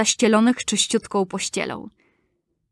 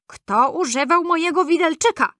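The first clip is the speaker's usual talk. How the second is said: fifth dwarf asking; 7 semitones higher than usual. A narrator's reading. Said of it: "Piąty".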